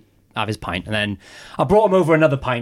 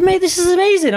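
mock amazed; 5 dB louder than usual. Joke teller voices his amazed self.

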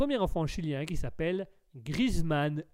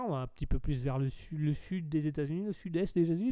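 ordinary talk, nothing marked

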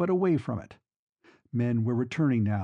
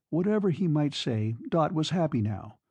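plain reading voice

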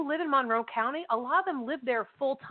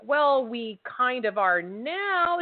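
awkward reply; much slower than usual. Retold or imitated. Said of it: "and I'm like".

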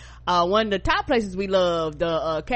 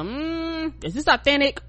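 a hint of a doubtful diner, pitched up; suspicious "is this authentic" asked higher; skeptical customer; 6 semitones higher, slower than usual. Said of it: "'cause you go".

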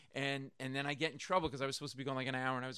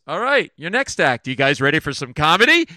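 announcer; 19 dB louder than usual. Retold or imitated. Emcee announcing next act.

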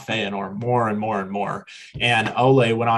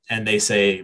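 ordinary talk, nothing marked

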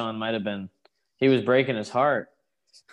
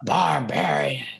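announcer impression; slower than usual. Mimicking announcer's call of wrestler.

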